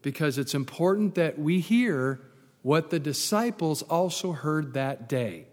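ordinary talk, nothing marked

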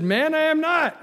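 high, loud protest; indignant denial. Acted. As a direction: deny it with voice shooting up. Peter's second denial, acted.

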